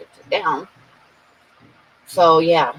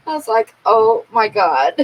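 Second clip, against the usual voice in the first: laughing; 4 semitones higher than usual. Real, not acted. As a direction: let the laugh carry the words. Retelling her reaction, laughing.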